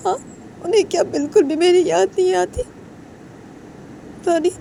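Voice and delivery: high, tearful sobbing plea to a friend; crying. Acted. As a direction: cry it out, voice breaking high and pleading. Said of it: "रोते रोते बोलता है".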